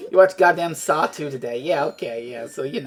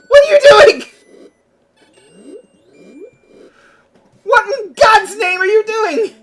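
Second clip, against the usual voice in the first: much higher, much louder disbelieving yell; incredulous outburst; 13 semitones higher, 9 dB louder than usual. Real, not acted. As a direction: shout at the absurd plot in disbelief. Incredulous reaction to the film.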